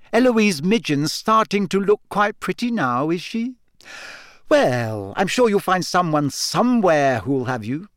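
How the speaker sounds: high, airy condescension; Hermione, lofty. A narrator's reading. Hermione needling Ron about finding a date, lofty. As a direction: needle him with haughty sweetness. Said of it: "said Hermione loftily".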